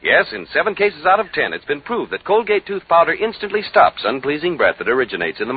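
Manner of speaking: smooth radio announcer pitch; ad read. Acted. Announcer selling Colgate tooth powder. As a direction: sell it like a radio ad.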